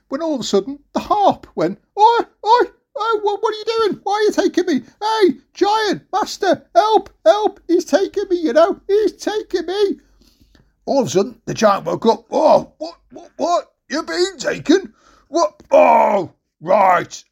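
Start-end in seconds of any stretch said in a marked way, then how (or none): 2.0-10.0 very high squawking cry for help; alarmed harp. Acted. Stolen harp calls the giant. 12.3-17.2 high, confused giant just woken up; startled giant. Acted. Giant woken by the harp's alarm.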